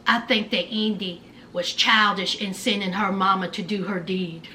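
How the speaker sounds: low mock Harry. Host imitating Harry's smug complaint in a low voice. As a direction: drop low and mumble it like Harry. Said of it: "And Harry says".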